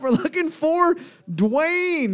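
drawn-out high announcement; slow announcing call. Acted. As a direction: stretch each word like a game host.

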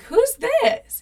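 very high, loud curious question; nosy surprise. Retold or imitated. Someone at a party asks about the partner, high imitated surprise. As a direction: imitate a squealing newcomer.

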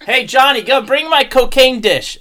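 loud, high mock parent summons; mock calling out. Joking parent sends Johnny for a dish, mock calling out. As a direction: call out to the kid in a put-on parent voice.

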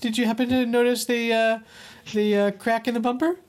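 very high, quick feigned innocence; high innocent voice. Retold or imitated. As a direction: squeak out a guilty question. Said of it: "your voice goes high when you".

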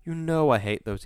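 moaning complaint. A narrator's reading. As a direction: complain with the pitch pushed up. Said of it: "Wisconski moaned to Hall".

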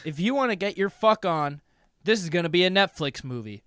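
hammed-up sponsor read. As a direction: pitch the promo code. Sponsor read mid-show.

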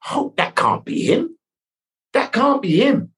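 slow, rising, disbelieving murmur; muttering alone after hanging up; stunned disbelief. Retold or imitated. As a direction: talk yourself out of it, slowly. Disbelief after phone call.

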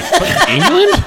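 high, slow disbelieving cry of England; mock outrage, voice jumping up high; incredulous. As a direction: act stunned to be called English. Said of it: "And I'm like".

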